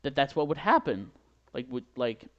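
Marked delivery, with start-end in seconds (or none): 0.0-1.1 louder, higher flustered excuse. Retold or imitated. Excusing the egg smell to the host, loud and flustered. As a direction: blurt the apology out loud. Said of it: "it was like".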